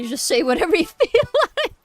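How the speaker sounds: fast, laughing through the words; giggling at own mistakes. Real, not acted. Laughing at herself.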